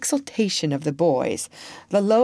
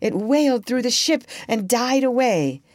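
plain reading voice

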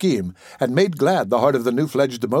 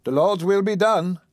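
higher-pitched pious resignation; resigned; 7 semitones higher than usual. Acted. Caretaker accepting the patient's bequest, resigned. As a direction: give in with an understated sigh of acceptance. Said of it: "replied the other with resignation".

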